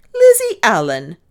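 loud, high exclamation of the name; sharp reprimand. A narrator's reading. Mistress interrupting maid's wild tale, sharp and loud. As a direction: cut her off with a loud rebuke.

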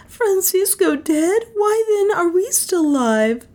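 acted tearful lament, pitched very high; wailing. A narrator's reading. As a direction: wail it in stricken disbelief. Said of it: "she wailed".

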